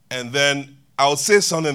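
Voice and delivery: ordinary talk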